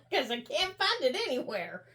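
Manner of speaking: giggling through the words; laughing. Real, not acted. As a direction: talk while still giggling. Laughing at lost glue.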